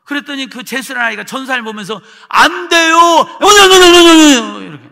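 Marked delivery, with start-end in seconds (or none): 2.3-4.7 high, very loud imitation of bawling; mock wailing. Boy crying out in protest to the evangelist, mock wailing. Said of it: "우는 소리로".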